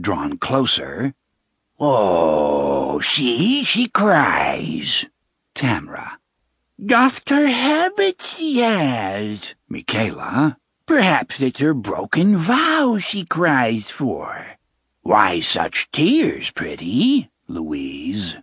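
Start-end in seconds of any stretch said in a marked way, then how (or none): 1.8-5.1 mocking witch voice. Sister mocks Jenna. 6.8-9.5 high, slow cackling hag voice; high mocking crone. Sister mocks Jenna. 10.8-14.6 high, slow witch voice sneering; high mocking crone. Sister mocks Jenna. 15.0-17.3 sneering witch whisper. Acted. Sister taunts Jenna.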